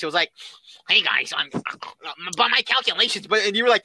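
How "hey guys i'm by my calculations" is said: put-on nerd caricature; Kevin. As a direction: say it like a cartoon nerd. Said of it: "stereotypical nerd voice".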